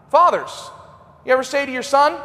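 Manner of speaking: normal talking voice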